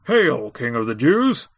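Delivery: slow, drawn-out mock homage; soldiers' jeering salute. A narrator's reading. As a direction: hail him with slow, scornful ceremony. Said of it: "mocked him, saying".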